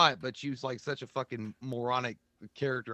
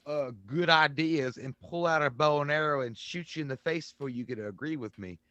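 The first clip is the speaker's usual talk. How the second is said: ordinary talk, nothing marked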